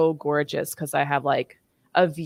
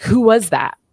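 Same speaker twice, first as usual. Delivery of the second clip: higher, louder mock demand; demanding question; 6 semitones higher, 9 dB louder than usual. Woman imitating her ex questioning her about a visitor, demanding. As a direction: imitate an indignant ex demanding an answer.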